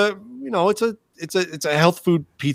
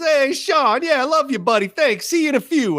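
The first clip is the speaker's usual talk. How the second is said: high, effusive Italian-American guy; hammed-up Italian phone voice; 12 semitones higher than usual. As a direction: imitate a gushing Italian pizza man, pitched way up. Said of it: "and he said it again".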